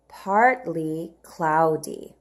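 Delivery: partly cloudy said slowly, stressing partly; slow model read. Shown as a demonstration. Teacher reading partly cloudy slowly to children. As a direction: sound it out slowly. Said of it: "Let's read the words together".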